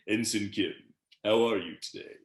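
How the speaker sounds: deep-toned, measured admiral impression; George Takei as Sulu. Sulu greets his ensign in a comedic scene, impersonated. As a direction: greet a junior officer in a deliberate, imitated voice. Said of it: "but me doing Sulu's voice".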